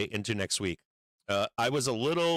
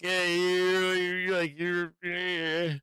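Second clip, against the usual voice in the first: mock sputter; 8 semitones higher, much slower than usual. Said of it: "And I'm like".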